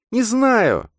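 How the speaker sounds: put-on very high, loud girl's cry; desperate cry. A narrator's reading. Girl has no answer for her friend, desperate cry. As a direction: cry out helplessly in a high feminine voice. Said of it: "отчаянно крикнула она".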